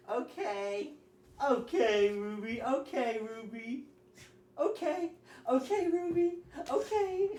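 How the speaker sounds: teasing okay. Shown as a demonstration. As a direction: keep teasing the dog, climbing. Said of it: "Ruby will freak out if you say".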